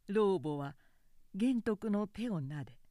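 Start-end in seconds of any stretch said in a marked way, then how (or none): none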